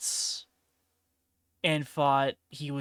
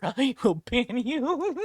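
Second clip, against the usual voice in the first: high, slow, laughing through the warning; laughing threat; 10 semitones higher, 5 dB louder, slower than usual. Real, not acted. Streamer laughing while threatening to ban Chad. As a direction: giggle out a ban threat you half mean.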